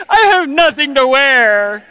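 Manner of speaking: loud, slow, drawn-out gripe; mock a kid's dramatic closet complaint; complaining kid. Kids open their closet and complain, loud mock wail.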